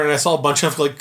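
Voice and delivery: ordinary talk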